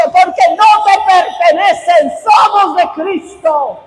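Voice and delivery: slow, very loud shout at Satan; thundering order to Satan; commanding rebuke. Acted. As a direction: cast the enemy out with full authority. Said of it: "pararte frente al enemigo y decirle".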